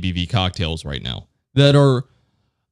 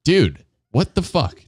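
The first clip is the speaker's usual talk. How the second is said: disgusted; 6 semitones higher than usual. Retold or imitated. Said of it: "in disgust and just goes".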